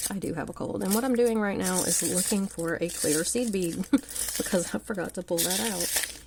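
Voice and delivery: ordinary talk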